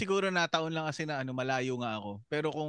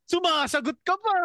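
higher-pitched mock parental rebuke; mock scolding parent; 8 semitones higher, 3 dB louder than usual. Mother to a child who questions her, mock scolding. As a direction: imitate a parent cutting off backtalk.

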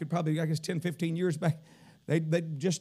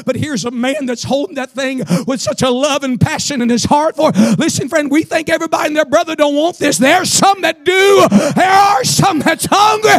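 rising revival preaching; 12 semitones higher, 18 dB louder than usual. Real, not acted. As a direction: build from firm to high, full-throated shouting. Preacher builds to full force.

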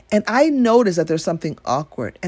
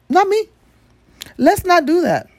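emphatic high exclamation; 10 semitones higher, 4 dB louder than usual. Real, not acted.

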